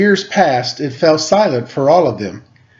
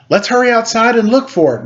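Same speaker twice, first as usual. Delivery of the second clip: put-on eager child; 8 semitones higher than usual. A narrator's reading. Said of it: "one of the children said".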